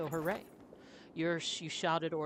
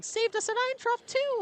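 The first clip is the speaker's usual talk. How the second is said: much higher, loud woman; woman's voice; 10 dB louder than usual. Acted. Woman in the crowd adds loudly, high-pitched.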